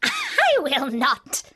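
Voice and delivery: slow, mocking witch voice; witch retorting. A narrator's reading. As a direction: drag out the flat refusal as the witch. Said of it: "retorted the witch".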